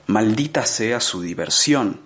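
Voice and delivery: plain reading voice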